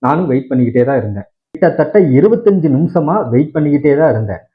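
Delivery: ordinary talk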